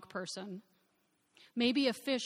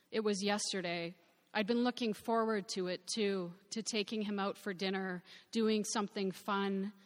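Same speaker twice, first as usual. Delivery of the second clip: plain reading voice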